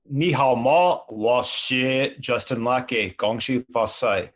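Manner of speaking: slow Mandarin greeting; spoken Mandarin. Shown as a demonstration. Guest greets the host in slow Mandarin on request. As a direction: say hello and your name in Chinese, slowly. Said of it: "in six different languages".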